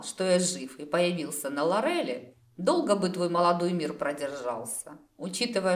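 usual speaking voice

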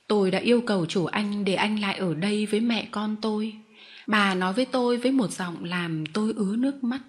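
plain reading voice

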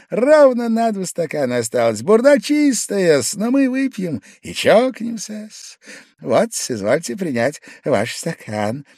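high-pitched, eager drinker; Pavel Pavlovich, eager. A narrator's reading. Guest pouring the last two glasses, high and eager. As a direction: press the glass on him in a high voice.

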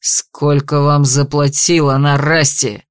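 low, slow barked demand; barking. Acted. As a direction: bark the question low. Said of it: "рявкнул дознаватель".

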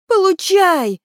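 high, loud battle shout; sharp cry. A narrator's reading. Kelly throwing the next stone at the keener, loud cry. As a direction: shout it as you hurl a stone. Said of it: "вскричал Келли".

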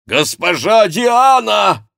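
high, loud, drawn-out call from afar; full-voiced calling shout. A narrator's reading. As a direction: bellow the name, stretching the vowels. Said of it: "кричал этот голос".

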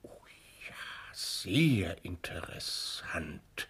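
a slow hushed aside while examining; whispered interest. Acted. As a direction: whisper it slowly, absorbed. Professor examines scar closely. (leise und langsam geflüstert)

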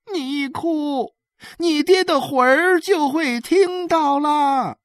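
high, drawn-out shout. A narrator's reading. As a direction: call up loudly to someone on the roof, each phrase stretched. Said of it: "何小勇的女人这时候对伊勒喊叫道".

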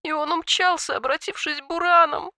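high wailing cry; wailing exclaim. A narrator's reading. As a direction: wail it out in grief. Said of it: "завывая, воскликнула драконица".

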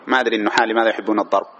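normal talking voice

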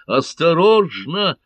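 high, loud, drawn-out cry of alarm; yelled warning. A narrator's reading. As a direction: yell a slow, urgent warning. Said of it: "завопил в этот миг каталонец".

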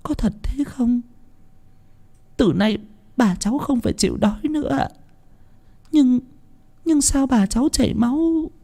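put-on high, soft naive child's questions; a small child not understanding death; wide-eyed little child's voice; innocent child. Naive child asks about grandma.

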